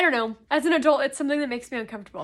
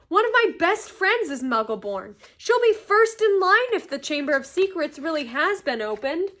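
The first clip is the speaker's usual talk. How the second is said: forceful, louder protest; fierce; 6 semitones higher, 6 dB louder than usual. A narrator's reading. Harry fearing for his friend, fierce. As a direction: argue it with urgent force. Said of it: "said Harry fiercely".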